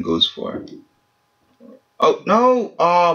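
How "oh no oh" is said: high sudden oh no; shocked cry. Real, not acted. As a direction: yelp at a costly mistake. Accidental sell mistake.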